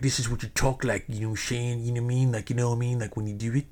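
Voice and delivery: imitating a put-on street accent, words slurred together; mock his affected accent, running the phrases together. Skinny guy talking tough at the show, in an affected accent. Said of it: "that affected accent".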